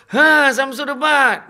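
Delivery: slow, loud, high drawn-out yes; neighbour's loud drawn-out answer; eager confirmation. Retold or imitated. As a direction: stretch the name out in delight.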